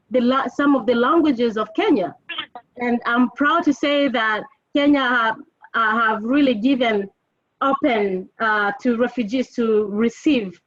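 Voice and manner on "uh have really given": usual speaking voice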